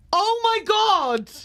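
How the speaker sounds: high, slow, drawn-out shock; stunned realisation. Real, not acted. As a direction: stretch it out in horrified amazement. Realises her anatomical mistake.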